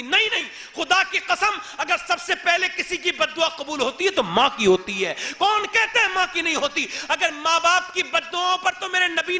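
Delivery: loud, high impassioned sermon; fiery preaching. Acted. Preacher warning the congregation about a mother's curse, loud fiery preaching. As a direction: hammer home the mother's curse. Preacher rises to full force.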